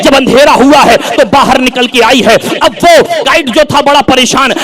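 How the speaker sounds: ordinary talk, nothing marked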